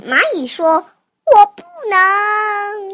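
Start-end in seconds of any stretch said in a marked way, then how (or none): 1.2-2.9 high, slow, drawn-out refusal; ant's refusal. Acted. Child as the ant refusing to swim, high drawn-out refusal. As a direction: stretch the last word out high and loud.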